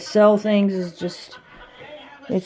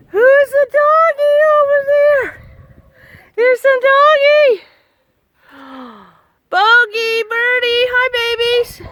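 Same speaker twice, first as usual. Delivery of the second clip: much higher, cooing voice for the dogs; doggy talk; 6 dB louder than usual. Acted. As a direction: coo at the dogs like babies. High pet talk to dogs.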